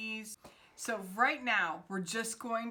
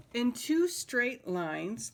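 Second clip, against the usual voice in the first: "two" stressed, lifted and louder; stressed reread; 4 semitones higher, 5 dB louder, slower than usual. Shown as a demonstration. Teacher rereads the line for the class, pressing "two". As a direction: lean on "two" in "In two straight lines", slow and louder. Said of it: "lived twelve little girls in two".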